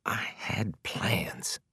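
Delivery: a crushed, slow whisper; broken whisper. Acted. Repeated as a whisper.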